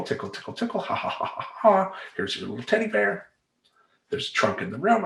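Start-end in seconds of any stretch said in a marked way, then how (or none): 0.0-1.9 playful tickle voice. Retold or imitated. Tickling son at bedtime. 2.2-3.3 put-on tender baby talk. Tucking son in.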